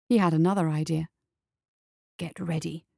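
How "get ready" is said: hushed, much softer aside; whisper. A narrator's reading. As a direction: whisper it secretly to an accomplice. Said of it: "he whispered to Sebastian".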